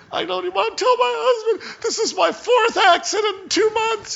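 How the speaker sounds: high, crying voice of the upset lady; tearful woman. Retold or imitated. Pastor imitates the crying lady who hit his truck. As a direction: wail it like the weeping driver.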